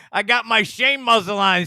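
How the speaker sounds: loud laughing jab at his muted mic. Real, not acted. Streamer laughing at his muzzled mic, loud laugh. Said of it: "what a muzzle on my mic".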